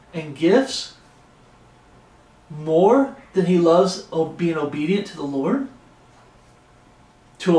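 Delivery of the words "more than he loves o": ordinary talk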